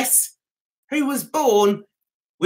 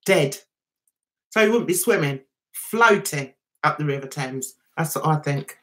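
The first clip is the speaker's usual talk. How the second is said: ordinary talk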